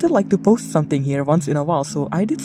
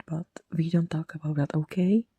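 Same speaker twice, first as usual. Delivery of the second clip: whispering; 9 dB quieter than usual. Pretend. Joking aside about being late.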